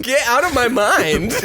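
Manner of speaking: put-on high, laughing Valley Girl reaction; mock Valley Girl amazement. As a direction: squeal it like an astonished girlfriend. Joining the Valley Girl bit, laughing.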